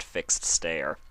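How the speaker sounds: plain reading voice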